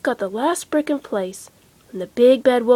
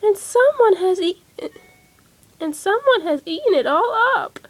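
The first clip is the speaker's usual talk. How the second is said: put-on little baby voice; 9 semitones higher than usual. A narrator's reading. Baby Bear cries over his chair, high baby voice. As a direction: squeak it out like a tiny cub. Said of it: "in a little baby voice".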